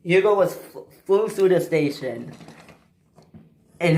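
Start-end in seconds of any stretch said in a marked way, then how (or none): none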